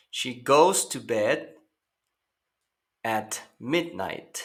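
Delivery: slow, careful English example; slow teacher model; slow model sentence. Shown as a demonstration. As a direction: read it slowly for learners. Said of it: "slow model sentence".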